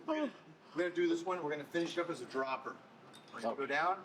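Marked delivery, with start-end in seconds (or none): 0.1-2.0 excited push. Real, not acted. Coach pushing through a set.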